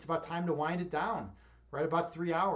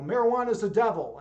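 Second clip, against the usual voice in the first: mock outrage; 3 semitones higher, 7 dB louder than usual. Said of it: "people are like".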